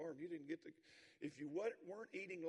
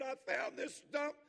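high, loud frustrated shout, slow at the end; a kid yelling his frustration at his dad; 10 semitones higher, 14 dB louder, slower than usual. Retold or imitated. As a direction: son yelling at his father about the stump. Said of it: "He's yelling at his dad".

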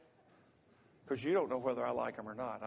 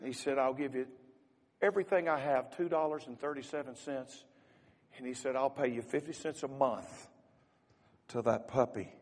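loud, high, impassioned story telling from the pulpit; fervent sermon storytelling; 5 semitones higher, 13 dB louder than usual. Acted. Preacher to congregation, telling the puppy story with loud fervor. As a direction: preach the story out with rising force.

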